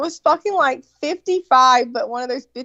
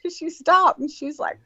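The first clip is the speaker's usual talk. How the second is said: normal talking voice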